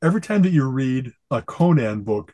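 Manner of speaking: normal talking voice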